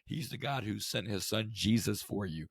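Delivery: ordinary talk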